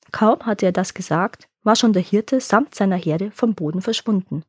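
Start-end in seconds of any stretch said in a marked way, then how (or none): none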